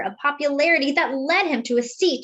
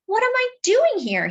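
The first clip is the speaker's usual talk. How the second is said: put-on much higher, a lonely young man's doubt; wondering aloud; 11 semitones higher than usual. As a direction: lift it high, asking the cold wind. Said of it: "he often wondered".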